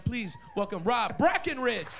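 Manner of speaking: ordinary talk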